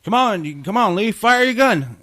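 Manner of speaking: high shouted urging under gunfire; frantic yelling. Acted. A soldier yelling at Lee to fire his gun, high frantic shout. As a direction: yell at Lee to shoot before they all die.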